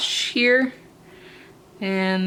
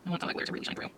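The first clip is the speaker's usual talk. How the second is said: whispering; 14 dB quieter, much faster than usual. Real, not acted. Unvoiced aside.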